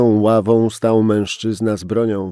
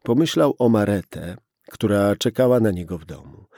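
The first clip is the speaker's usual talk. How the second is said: usual speaking voice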